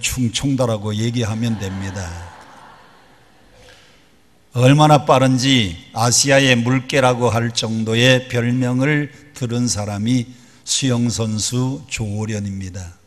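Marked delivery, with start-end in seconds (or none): none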